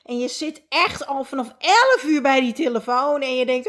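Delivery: ordinary delivery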